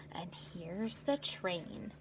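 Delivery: slow unvoiced whisper; soft secret whisper. Real, not acted. Whispered aside to children.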